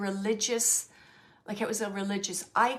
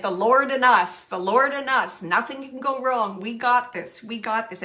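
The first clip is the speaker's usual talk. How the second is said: spirits' quote; 9 dB louder than usual. Retold or imitated. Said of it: "They're saying, like".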